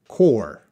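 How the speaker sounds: "core" as the correct CORPS, ending on the R; silent P and S. Shown as a demonstration. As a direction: model "core" alone, clearly, with falling pitch. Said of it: "we would pronounce that".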